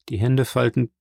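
ordinary delivery

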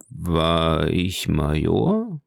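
unsure, drawn-out low question; shy question. A narrator's reading. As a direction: ask it hesitantly, drawing the words out. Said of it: "fragte schüchtern der alte Soldat".

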